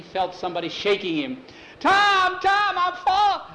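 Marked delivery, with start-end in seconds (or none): 1.8-3.5 high, frantic cry cut short; loud shriek mid-nightmare; panicked scream. Acted. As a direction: scream it as you are shaken awake. Waking from falling nightmare.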